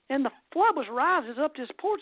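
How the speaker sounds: ordinary delivery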